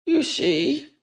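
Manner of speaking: high, urgent aside; high voiced aside. Hrothgar whispering to Sigurd, high and urgent. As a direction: nudge your friend with a high aside.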